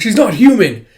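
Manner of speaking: high, loud insistence; panicked claim. Retold or imitated. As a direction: swear she isn't human. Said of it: "It's like".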